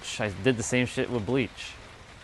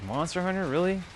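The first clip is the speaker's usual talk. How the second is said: higher incredulous scoff; scornful disbelief; 7 semitones higher than usual. Retold or imitated. Streamer mocking the game he later played, high incredulous scoff.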